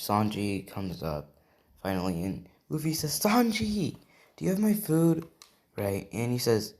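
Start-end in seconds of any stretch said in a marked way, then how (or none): 3.2-5.3 high, loud acted call to a friend; eager calling out. Luffy asks Sanji for his food, eager calling out. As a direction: call out eagerly, pitched well up.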